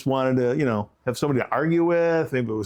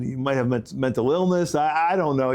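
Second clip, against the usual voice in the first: ordinary delivery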